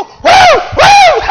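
put-on very high, loud girlish whoops; little girl's whoops. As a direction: imitate a girl shouting for joy. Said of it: "she go".

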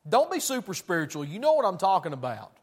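loud, high-pitched pulpit preaching; fiery preaching. Acted. Preacher to his congregation, fiery full-force preaching.

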